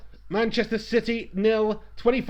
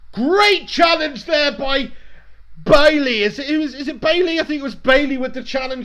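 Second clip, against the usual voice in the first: loud, high praise for a tackle; excited commentary; 7 semitones higher, 8 dB louder than usual. Real, not acted. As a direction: call out the tackle, thrilled. Live reaction to big tackle.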